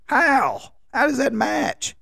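high incredulous squeak of disbelief. Retold or imitated. Husband questioning wife's matching, high incredulous. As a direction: pitch way up in mock disbelief. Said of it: "I'm like".

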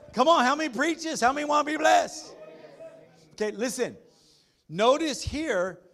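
high, loud preaching to the congregation; full-force preaching. Acted. Pastor firing up his church about blessing, loud and high. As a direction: rouse the room, call for amens.

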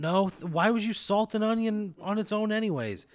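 loud, high exasperated objection; baffled protest at full volume. Acted. Exasperated with the odd visitor.

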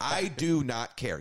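"I do not care" slowed right down, each word stressed; emphatic refusal. Real, not acted. Emphatic repeat of own stance.

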